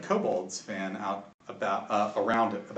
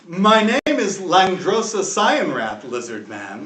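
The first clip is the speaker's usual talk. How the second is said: slow, louder proud self-introduction; bemused half-dragon; 4 semitones higher, 7 dB louder, slower than usual. Acted. Half-dragon introducing himself to the lizard man, slow proud voice. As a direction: announce your name with relish. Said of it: "he's like".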